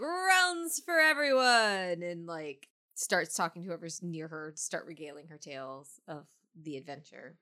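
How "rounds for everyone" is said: high, loud, drawn-out holler; long celebratory bar-room shout; shouted toast. Acted. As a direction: call out to the whole bar. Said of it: "she's like".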